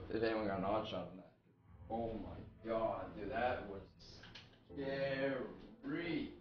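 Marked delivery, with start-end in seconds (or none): 1.9-6.3 shaken. Real, not acted. Shaken right after a jump scare.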